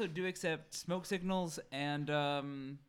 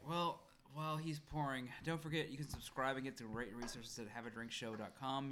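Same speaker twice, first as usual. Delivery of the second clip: ordinary talk, nothing marked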